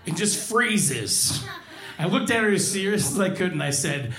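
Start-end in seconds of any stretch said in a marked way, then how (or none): none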